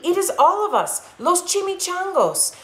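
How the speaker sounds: high little puppy leader; small puppy voice. Acted. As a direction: squeak it out like the smallest pup. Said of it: "said Poquito Tito".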